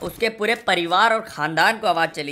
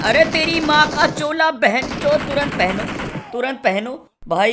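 sudden shouted swearing, much higher and louder; shocked outburst; 8 semitones higher, 6 dB louder than usual. Real, not acted. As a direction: yelp in real shock, then bark the order. Sudden surprise kill by enemy.

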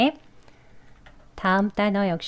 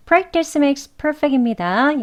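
motto; 5 semitones higher, much slower than usual. Acted. Closing catchphrase.

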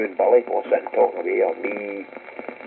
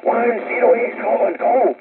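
shouting call sign; 13 semitones higher than usual. Retold or imitated. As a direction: bellow the call sign like the guy in Fife. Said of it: "just keeps shouting".

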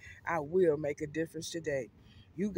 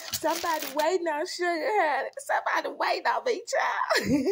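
high, squealing and giddy, a bit faster; 9 semitones higher than usual. Real, not acted. Vlogger squealing to followers about the food. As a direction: gush with delight like you can't wait.

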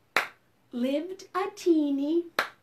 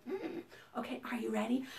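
usual speaking voice

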